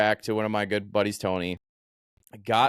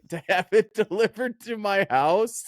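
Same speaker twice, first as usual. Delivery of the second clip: slow, high-pitched disbelief; incredulous mock confrontation, stretched out; retold incredulity; 7 semitones higher, slower than usual. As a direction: spell out the outrage, drawn out and high. Confronting friend over prank pizza.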